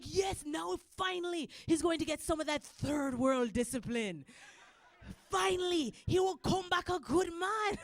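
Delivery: high, excited Jamaican dad impression. Dad glad his son faces tough discipline, excited. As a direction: rejoice loudly as the dad. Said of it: "he was excited".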